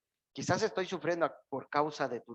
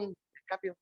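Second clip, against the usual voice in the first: ordinary talk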